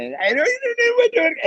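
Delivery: Pittsburgh Yinzer shooting up into a squeal; exaggerated high Yinzer voice; high-pitched Yinzer accent. Shown as a demonstration. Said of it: "a high pitched voice".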